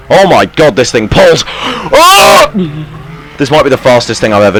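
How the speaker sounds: loud, high, thrilled cry; excited. Real, not acted. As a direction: whoop with thrill. Boat suddenly speeds off.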